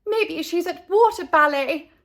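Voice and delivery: mother calling out a guess. Acted. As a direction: call the idea out. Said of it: "called Missus Buckmeister".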